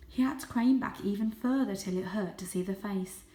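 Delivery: ordinary delivery, nothing marked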